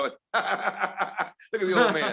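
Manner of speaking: slow, laughing mockery of the old man; teasing daughters laughing at dad; mocking laugh. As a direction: drawl it out through a laugh. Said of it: "They just look at me and go".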